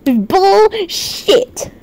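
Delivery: BULLSHIT split into two long syllables, rising; drawn-out emphasis. Real, not acted. Streamer rejecting the accusation, drawn-out emphatic retort. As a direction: stretch "Bullshit" out, pausing before the second half.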